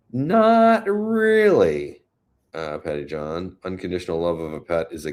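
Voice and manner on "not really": not really, stretched slow, high and loud; mock dismay. Real, not acted. Answering a viewer's hopeful comment with drawn-out dismay.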